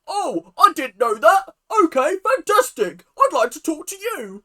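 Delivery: high, loud mock-sassy British man; over-the-top sassy British impression. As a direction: sass it up as a hammed-up Brit, loud and high. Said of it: "British man sassy".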